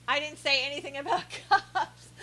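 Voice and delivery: amused, giggling through the end; laughing. Real, not acted. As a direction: laugh breaking into the last words. Amused reading a chat protest.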